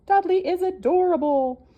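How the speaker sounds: high, loud hen chorus voice; cooing hens. Acted. The barnyard hens cooing over Dudley, high and loud. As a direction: coo it high like a flock of hens. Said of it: "chorused all the hens".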